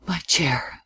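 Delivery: soft, half to himself; muttered to himself. A narrator's reading. Man in his chair, murmuring to himself. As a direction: murmur it as if alone. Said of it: "as if to himself".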